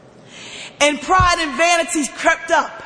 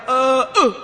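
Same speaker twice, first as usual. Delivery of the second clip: mimicking a blocked stutter; stalled, stuttering "uh"; imitated lock stutter; 3 semitones lower than usual. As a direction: get stuck on one grunted sound. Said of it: "he would stutter and kinda like a lock stutter".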